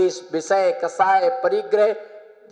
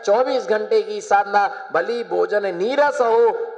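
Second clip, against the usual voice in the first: usual speaking voice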